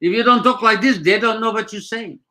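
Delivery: put-on Armenian accent. Shown as a demonstration. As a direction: talk in a thick demo Armenian accent. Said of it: "how to do an Armenian accent".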